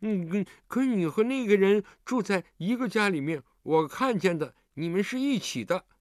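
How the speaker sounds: put-on high child voice round a mouthful; muffled, high-pitched little kid; mumbling, mouth full. A narrator's reading. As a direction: talk through a mouthful of pastry like a kid. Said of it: "寒冰嘴里塞着点心，含含糊糊地说".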